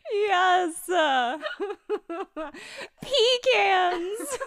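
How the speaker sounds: high-pitched mock delight with laughter; mock cheering. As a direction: play a thrilled listener, high and laughing. Imagined bisexual listeners cheering in car.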